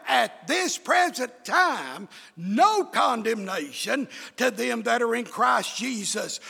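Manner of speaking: rising revival sermon drive; full-force preaching. Real, not acted. Preacher testifying to his faith and trust, building full-force preaching. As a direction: build phrase by phrase to a loud peak.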